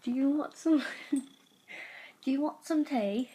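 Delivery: put-on British accent, lifted and laughing. Offering the cat tea, British accent. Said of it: "British accents I do very well".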